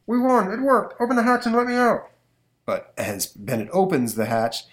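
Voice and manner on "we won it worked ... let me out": high, fast villain gloat. Acted. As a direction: crow over the trick, high and fast. Eclipso gloats.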